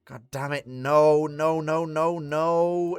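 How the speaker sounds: loud first cry, then rising pleas; real dismay. Real, not acted. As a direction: protest in mounting frustration at bad luck. Dismay at a rudder hit.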